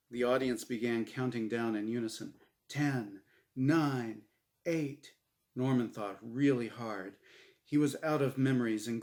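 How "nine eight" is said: ordinary delivery, nothing marked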